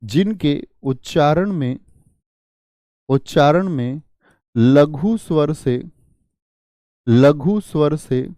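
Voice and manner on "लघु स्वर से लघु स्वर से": slow, measured repeat for note-taking; slow dictation. Shown as a demonstration. Teacher repeats the definition so students can write, slow dictation. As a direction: dictate it slowly, phrase by phrase.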